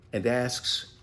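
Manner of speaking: ordinary delivery, nothing marked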